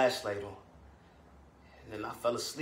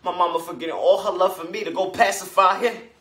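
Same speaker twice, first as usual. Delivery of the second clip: loud, heated complaint; indignant son; 5 semitones higher, 9 dB louder than usual. Acted. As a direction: throw it out loud, hurt and mad. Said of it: "And that got me mad".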